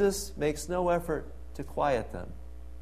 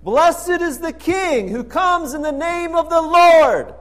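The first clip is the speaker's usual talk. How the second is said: much higher, loud ecstatic acclamation; crowd's shout; 11 semitones higher, 12 dB louder than usual. Retold or imitated. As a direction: cry it out like the cheering crowd. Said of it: "They shouted".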